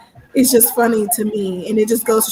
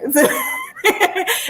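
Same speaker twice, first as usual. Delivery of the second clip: bright burst of laughter; high laugh; 11 semitones higher than usual. Real, not acted. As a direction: laugh at the awkwardness. Laughs at awkward moment.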